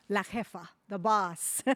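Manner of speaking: ordinary talk, nothing marked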